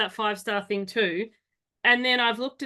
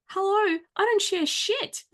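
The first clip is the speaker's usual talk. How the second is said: high-pitched, drawn-out mock indignation; exaggerated offended comeback, pitched way up; indignant retort; 9 semitones higher, slower than usual. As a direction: set them straight, high and incredulous. Acting out retort to share request.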